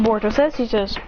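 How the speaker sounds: ordinary talk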